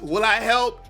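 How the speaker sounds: normal talking voice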